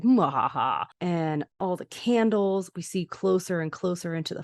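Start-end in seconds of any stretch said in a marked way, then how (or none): 0.0-0.8 imitated low villain laugh; mock haunted-house cackle, pitch dropping; evil cackle. Imitating the haunted house's sounds, evil cackle. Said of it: "the sounds of, like".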